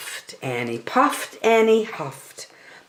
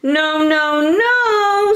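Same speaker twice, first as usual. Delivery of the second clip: high frightened little wolves, rising on the last no; high, timid cub voices; squeaky little wolves; 10 semitones higher, 4 dB louder, much slower than usual. A narrator's reading. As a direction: refuse in tiny scared voices. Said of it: "said the three little wolves".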